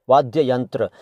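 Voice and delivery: ordinary delivery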